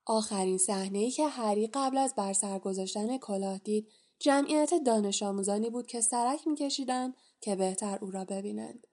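usual speaking voice

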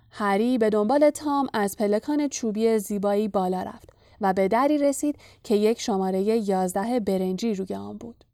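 usual speaking voice

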